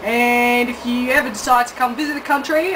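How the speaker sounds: ordinary delivery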